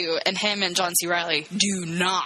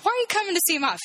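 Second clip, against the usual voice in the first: high-pitched, exasperated question; incredulous scolding; 11 semitones higher, much faster than usual. Pretend. Viewer questioning movie woman, incredulous scolding. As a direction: demand sense from a movie character.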